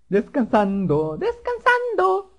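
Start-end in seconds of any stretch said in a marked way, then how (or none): 1.2-2.4 very high, slow drawn-out squeak; silly falsetto. Pretend. Player narrates his character resting, silly falsetto. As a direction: stretch it out in a tiny falsetto voice.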